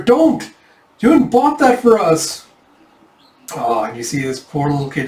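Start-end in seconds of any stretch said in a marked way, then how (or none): 0.0-2.4 high, loud pleading shout; child's high shout. Acted.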